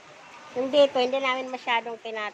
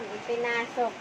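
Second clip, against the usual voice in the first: usual speaking voice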